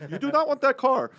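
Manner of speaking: stern Nigerian dad, loud and high; a Nigerian father refusing his sons, in a Nigerian accent. Retold or imitated. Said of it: "That's my Nigerian accent".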